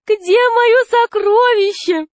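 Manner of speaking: slow, squeaky-high cooing fat man; thin high voice. A narrator's reading. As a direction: pipe it out in falsetto, drawn out. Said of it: "сказал толстяк тонким голосом".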